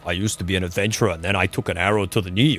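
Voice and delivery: put-on low, quick Skyrim guard with a Nordic accent. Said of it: "when I think of the Nordic accent".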